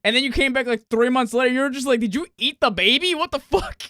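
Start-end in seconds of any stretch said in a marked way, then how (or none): 2.0-3.8 rising, higher disbelief at the big dog; a friend's mock double take; mock shock. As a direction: act stunned and appalled.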